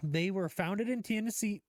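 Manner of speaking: Tennessee slipping into a southern twang, almost Twenessee. Acted. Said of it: "I started to say it with, like, a southern twang".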